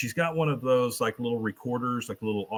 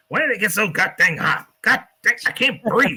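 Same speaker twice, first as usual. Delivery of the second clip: put-on much higher, louder, frantic old man; panicked old man; 8 semitones higher, 6 dB louder than usual. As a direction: gasp it out in a panic. Cotton panicking on the plane.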